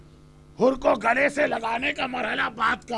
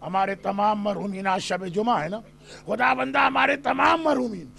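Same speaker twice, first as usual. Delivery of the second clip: softer, lower supplication after the sermon; closing prayer; 3 semitones lower, 4 dB quieter than usual. Acted. Preacher ends the majlis praying for the mourners, soft supplication. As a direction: plead to God quietly and earnestly.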